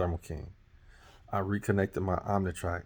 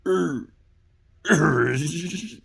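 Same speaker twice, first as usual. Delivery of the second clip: high, strained mock groan of a dying engine; engine failing to start; 8 semitones higher, 5 dB louder than usual. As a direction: groan like a truck that won't turn over. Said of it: "it just was like".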